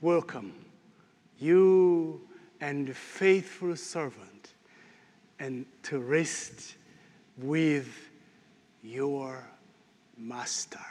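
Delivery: gentle words of a heavenly welcome; tender welcome. Retold or imitated. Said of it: "you'll hear the words ring".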